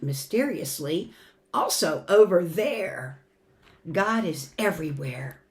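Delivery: storybook reading with swelling and hushed lines; gentle expressive story voice for the kids; reading to children. Acted. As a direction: read it like a bedtime picture book. Reads picture book to congregation.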